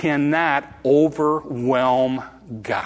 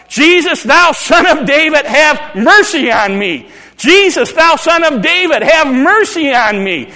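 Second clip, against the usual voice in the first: much higher, loud pleading shout; calling out; 10 semitones higher, 7 dB louder than usual. Pretend. Bartimaeus shouting over the crowd to Jesus, desperate. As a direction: cry out to be heard over everyone. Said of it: "I'm gonna keep calling out".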